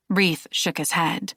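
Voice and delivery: ordinary delivery, nothing marked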